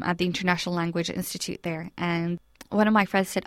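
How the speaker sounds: usual speaking voice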